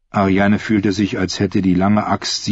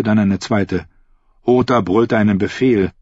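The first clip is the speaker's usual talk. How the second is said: plain reading voice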